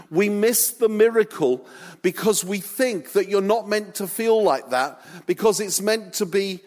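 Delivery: impassioned preaching; preaching at full force. Acted. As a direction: press the point home to the congregation. Preaching climax of sermon.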